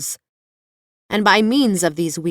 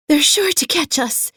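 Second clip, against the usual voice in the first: higher, louder breathless cry; panting; 9 semitones higher, 4 dB louder than usual. A narrator's reading. Dorothy running from wheelers, breathless panting cry. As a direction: gasp it out while running. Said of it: "panted the girl".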